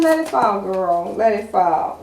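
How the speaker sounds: sassy card voice. Retold or imitated.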